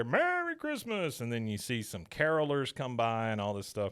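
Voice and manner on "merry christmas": high, drawn-out holiday call; slow high-pitched mock holler; Bill calling greetings. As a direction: sing out a greeting from far back. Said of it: "you hear Bill back there".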